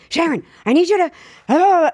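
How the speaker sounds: a frantic agent on the phone, mimicked; mock panic. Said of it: "She'd call me up in a panic".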